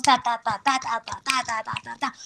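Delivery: agile staccato "ta" syllables; quick comic rhythm. Shown as a demonstration. Actress showing the timing of a comic text, quick and agile. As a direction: rattle off light, nimble beats. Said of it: "tan rápido, tan ágil".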